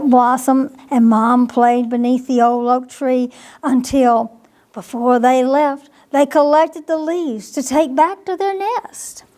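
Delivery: usual speaking voice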